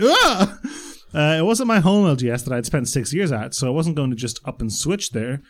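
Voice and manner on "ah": short, high-pitched joke scream; higher, hammed-up "Ah!"; mock horror scream. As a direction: let out a playful fright cry. Mock scream at horror story ending.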